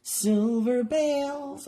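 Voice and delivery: Silver bales sung out high and drawn out; high, slow, silly Silver bales; silly accent. Shown as a demonstration. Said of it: "I have to say it with that silly accent".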